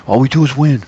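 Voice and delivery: half-hearted boastful Trump brag; Trump impression. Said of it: "my imitation's not that good".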